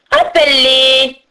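slow, drawn-out witch's order; imperious old ogress voice; commanding ogress. A narrator's reading. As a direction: order it like a menacing hag. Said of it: "ordonna la vieille femme".

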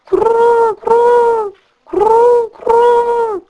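crou très aigu et fort, descendant; plainte de pigeon. Acted. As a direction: roucoulez votre deuil d'oiseau. Pigeons pleurent leurs petits. (pigeons endeuillés, roucoulement aigu et fort)

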